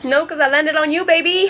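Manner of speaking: put-on smooth line rising high on baby; cheesy pickup line. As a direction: deliver the pickup line with a grin. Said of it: "Did it hurt when you fell from heaven".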